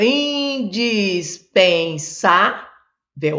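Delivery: "Indispensável" drawn out very slowly in separate syllables. Shown as a demonstration. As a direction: stretch each syllable of "Indispensável". Teacher separating syllables of next word.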